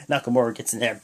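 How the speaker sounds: ordinary delivery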